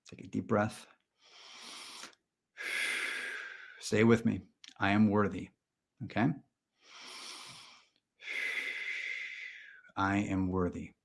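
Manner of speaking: hushed, deliberate self-help guru delivery; mock guided meditation. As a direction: lead a breathing exercise, leaving long pauses. Leads a mock mantra exercise.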